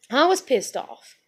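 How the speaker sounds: ordinary talk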